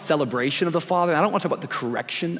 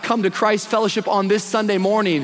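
rising, intensifying pulpit crescendo; impassioned preacher gathering force; preaching; 4 semitones higher, 3 dB louder than usual. Real, not acted. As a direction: build phrase by phrase to a high, forceful declaration. Preaching builds to a climax.

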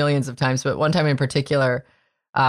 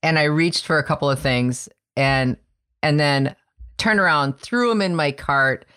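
usual speaking voice

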